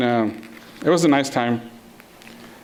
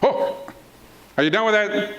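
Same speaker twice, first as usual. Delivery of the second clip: lightly voiced dad, raised pitch; dad checking in; 6 semitones higher, slower than usual. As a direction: call over like a dad checking on the work. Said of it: "and then he comes".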